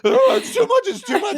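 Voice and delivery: very high, hammed-up freak-out; mouth-full panic. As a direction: squeal it as if choking on a mouthful. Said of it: "got all of it in his mouth".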